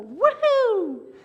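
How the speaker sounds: high, loud whooping shout; bouncer's whoop. Retold or imitated. Bouncer tossing a rowdy guy out, loud whoop. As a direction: heave him out the door with a big whoop. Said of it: "they take you by the scruff and go".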